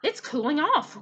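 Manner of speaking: high, loud croaky frog; croaking frog. Acted. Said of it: "croaked one of the frogs".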